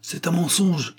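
high-pitched cry of denial; protesting outcry. A narrator's reading. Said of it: "s'écria Javens".

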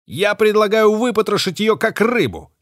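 high, loud eager outburst; blurted out. A narrator's reading. Thug Bait urging to gut the captive, blurting loudly. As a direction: blurt it out, keen for blood. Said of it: "выпалил Бейт".

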